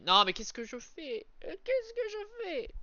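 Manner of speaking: high, panicked squeal. Real, not acted. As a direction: shriek at your own mistake. Panicked at own mistake in game.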